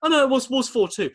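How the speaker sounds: mock self-correction, high-pitched; Jack Charlton. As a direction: imitate a flustered pundit correcting himself, high voice. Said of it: "Three times he checks himself".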